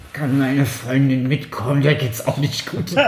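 slow, dragged-out whiny sister voice; slurred, slow pleading drunk sister. Retold or imitated. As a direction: drawl it like a tipsy sister begging. Voicing the sister's whiny request.

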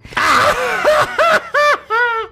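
loud, very high witch cackle; evil queen cackle. Acted. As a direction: cackle triumphantly like a wicked queen. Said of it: "und lachte".

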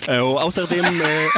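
usual speaking voice

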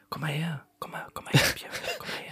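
whispered, breathy coaxing, all in jest; mock tender whisper. Host coaxing co-host into his arms, mock tender whisper. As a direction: coax him close in a playful hushed whisper.

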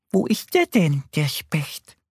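high, whispery little duck; high, airy character voice. A narrator's reading. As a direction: ask as a puzzled little duck. Duck Annie asks in story.

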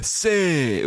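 high but contained fan call; imitated answering shout. The other fans answering in a retold stadium chant, high mock shout. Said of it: "die anderen haben geschrien".

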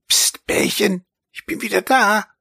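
a light, squeaky little mouse voice, hushed; lightly voiced tiny mouse; squeaky mouse whisper. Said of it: "piepste es ganz leise".

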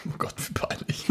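embarrassed whisper. Real, not acted. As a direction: whisper it to yourself. Embarrassed by wrong answer.

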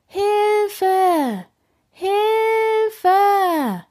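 high, drawn-out cries for help; calling for help. A narrator's reading. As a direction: keep calling out from the treetop. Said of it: "ruft sie".

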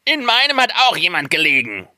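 high-pitched dwarf cry; calling out. A narrator's reading. Other dwarfs come running, calling out high. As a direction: call it out high as an excited dwarf. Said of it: "kamen gelaufen und riefen".

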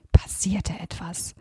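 suspenseful whisper. A narrator's reading. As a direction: breathe it out in a hush. Suspense in story.

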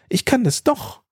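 ordinary delivery

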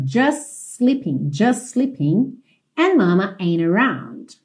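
"Just sleeping" run together on a drawn-out S; slowly, dropping T's in "Just" and "ain't"; linked S. Shown as a demonstration. Teacher modelling the linking, "Just sleeping" as one slow phrase. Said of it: "ligadinho pelo S".

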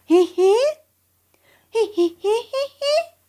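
high squeaky questioning giggle; ball squeak. Acted.

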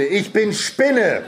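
drawn-out, high-pitched teasing; mocking. A narrator's reading. Brother teases the boy over his guess, mocking. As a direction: stretch it slowly in mockery.

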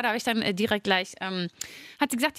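usual speaking voice

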